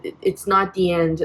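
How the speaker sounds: ordinary talk, nothing marked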